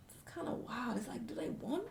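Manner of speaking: near-whispered, wondering aside; hushed musing. Retold or imitated. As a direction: drop to a whisper as if thinking aloud. Hushed reaction to scared commenters.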